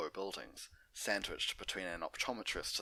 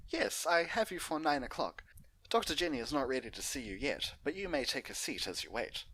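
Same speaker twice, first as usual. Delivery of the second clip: receptionist; 7 semitones higher, 4 dB louder than usual. Acted. Receptionist voiced in story.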